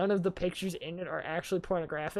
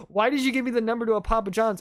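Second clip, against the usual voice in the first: imitating a freaked-out friend, louder; a friend freaking out at the prank, louder; mock freaked out; 4 semitones higher, 6 dB louder than usual. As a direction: ask it as a panicked friend, pitched up. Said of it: "she's, like, freaking out".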